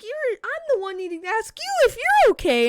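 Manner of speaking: high-pitched, incredulous mother's voice; exasperated mom. Retold or imitated. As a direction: imitate a frantic mom protesting. Worried mother's exasperated reply.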